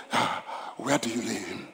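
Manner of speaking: hushed, near-whispered question; soft sorrowful. Acted. Jesus asking Martha softly where Lazarus lies, hushed. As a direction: breathe it out with grief.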